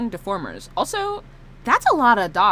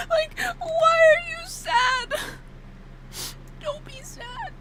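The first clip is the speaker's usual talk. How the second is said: tearful pleading. Pretend. Streamer pleading with the sad toggle, high whimpering. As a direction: plead in a tiny high crying squeak.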